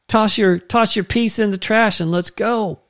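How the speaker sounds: high, hammed-up urgency; mock panic. Preacher spoofing fugitives hiding when the cops come, in mock panic. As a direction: pretend to hustle the gang away. Mocking fugitives fleeing police.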